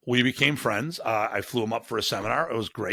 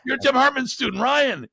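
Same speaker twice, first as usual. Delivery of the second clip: much higher and louder, starstruck; thrilled fan recognizing Ryan; gushing fan; 13 semitones higher, 4 dB louder than usual. Retold or imitated. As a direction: squeal it like a fan meeting a hero's student. Fans gushing over student.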